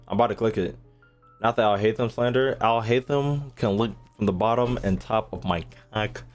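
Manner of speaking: usual speaking voice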